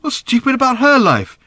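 high, loud challenge; insistent. A narrator's reading. Father defends her mother to his daughter, insisting. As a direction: press the question hard. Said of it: "insisted Arthur".

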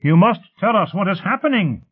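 high-pitched urgent shouted demand; shouting. A narrator's reading. The elite demand news of Habiba, urgent shouting. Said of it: "one of the elite shouted".

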